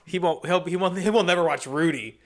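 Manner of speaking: ordinary talk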